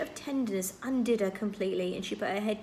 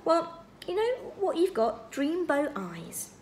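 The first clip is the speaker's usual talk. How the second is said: slow, higher, savoured compliment; admiring; 8 semitones higher, slower than usual. A narrator's reading. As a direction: draw it out in admiration. Calvin admires Meg's eyes.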